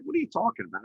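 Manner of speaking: high, startled disbelief; incredulous. Retold or imitated. To wife about the country club, high startled disbelief. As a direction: blurt it out, caught off guard. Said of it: "I'm like".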